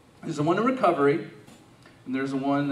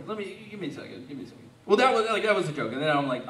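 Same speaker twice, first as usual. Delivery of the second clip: ordinary delivery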